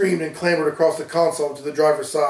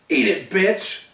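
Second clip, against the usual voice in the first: a touch of raised, rabid menace; rabid snarl; 5 semitones higher, slower than usual. A narrator's reading. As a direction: spit it out like a mad dog. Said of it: "came Justin's rabid voice".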